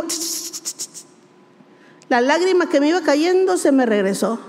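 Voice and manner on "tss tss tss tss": soft unvoiced shushing tss tss; God quieting a crying mother with soft shushing; hushing tss. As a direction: hush someone gently with tss tss. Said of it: "y el Señor me dijo".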